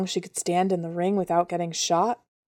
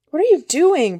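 angry shout, high and loud; growling; 9 semitones higher, 6 dB louder than usual. Acted. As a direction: snarl at her, startled and angry. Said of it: "Brighton growled".

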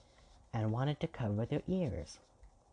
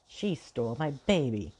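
loud, raised accusation; loud declaration; 4 semitones higher, 6 dB louder than usual. A narrator's reading. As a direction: declare it hotly. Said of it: "Queen declared as she drew".

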